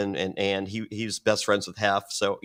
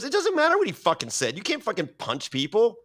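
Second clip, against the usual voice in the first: high-pitched, indignant retold rebuke; retold scolding; 10 semitones higher than usual. Host telling off a woman who punched someone, mock indignant rebuke. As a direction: cut off the excuse with a raised pitch.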